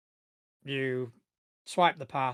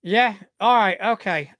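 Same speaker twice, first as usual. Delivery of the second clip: louder, higher-voiced guard acknowledging; security guard; 5 semitones higher, 8 dB louder than usual. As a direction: accept it as the hesitant guard. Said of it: "security guard".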